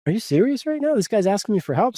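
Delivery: incredulous. Retold or imitated. Disbelief at ex asking help.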